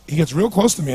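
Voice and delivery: usual speaking voice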